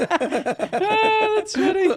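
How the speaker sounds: laughing oh that's funny. Real, not acted. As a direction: crack up at the joke. Cracking up at story.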